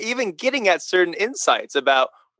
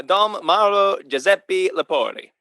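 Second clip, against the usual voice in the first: higher, measured Italian-style reading; Italian pronunciation; 4 semitones higher than usual. Shown as a demonstration. Priest corrects the name for his co-host, Italian pronunciation. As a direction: give the name its proper Italian sounds. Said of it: "It's really, uh".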